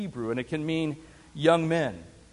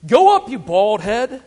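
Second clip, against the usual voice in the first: louder mocking jeer; jeering taunt; 4 semitones higher, 10 dB louder than usual. A narrator's reading. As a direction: taunt him like a rude boy. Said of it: "jeered at him, saying".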